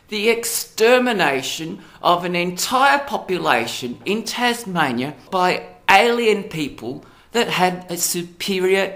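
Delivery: high and loud, shocked outrage; real furious disbelief building into a rant; outraged. Real, not acted. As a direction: let the horror spill out loud and high. Outraged rant about colonial extermination.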